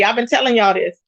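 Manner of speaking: ordinary delivery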